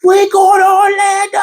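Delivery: high loud cheer; high, loud victory cheer; celebrating. Retold or imitated. Celebrating the win.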